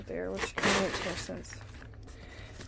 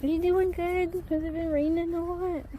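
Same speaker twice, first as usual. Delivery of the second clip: higher sweet pet voice; cooing; 8 semitones higher than usual. Real, not acted. Chatting with her horse in sweet cooing voice. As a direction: coo it sweetly.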